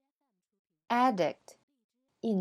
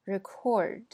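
Record stressed on CORD; verb stress. Shown as a demonstration. Teacher repeats the verb, stress on CORD. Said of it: "后边是动词".

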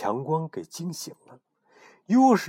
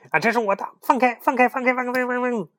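high, loud frantic let go; high, loud frantic yell; frantic shouting; 14 semitones higher, 6 dB louder, faster than usual. Acted. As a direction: yell in a panic over and over. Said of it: "急得大喊".

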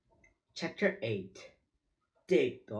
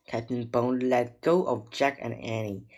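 ordinary delivery, nothing marked

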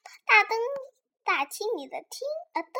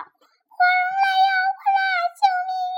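very high, loud cry for help; high-pitched hammed-up scream; shrieked alarm; 9 dB louder, much faster than usual. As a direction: wail in panic as a frightened auntie. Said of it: "一文大婶 喊道".

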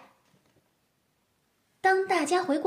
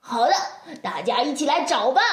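loud, higher excited call; 4 semitones higher, 4 dB louder, much faster than usual. Acted. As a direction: call everyone over eagerly. Kids call friends excitedly.

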